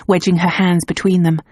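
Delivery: ordinary delivery, nothing marked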